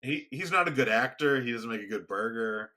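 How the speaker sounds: normal talking voice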